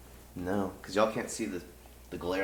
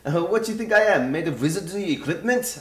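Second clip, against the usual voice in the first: put-on Irish accent; 8 semitones higher, 7 dB louder than usual. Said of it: "I keep getting his voice off".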